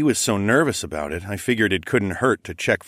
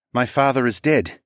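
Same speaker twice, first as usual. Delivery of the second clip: a touch of sadness, slightly raised pitch; tearful; 5 semitones higher, 3 dB louder than usual. Acted. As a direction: take it in hesitantly, near tears. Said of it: "wiped away another tear".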